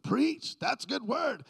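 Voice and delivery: high, punched mock shout of approval; imitated excited cheer. Preacher mimics Ava's reaction to his message, high excited cheer.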